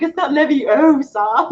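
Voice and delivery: high, slow, prim correction; "It's not Leviosa" drawn out slowly like a know-it-all; mock Hermione correcting, high and slow; Hermione impression. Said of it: "It's like".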